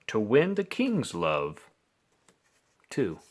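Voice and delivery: ordinary delivery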